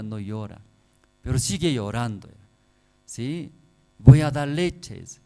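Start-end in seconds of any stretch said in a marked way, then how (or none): 4.0-5.2 loud, slow coaxing mother; mother pleading loudly. Retold or imitated. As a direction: coax the crying baby, loud and drawn out. Madre intenta calmar al bebé. (madre insistente, en voz alta y lenta)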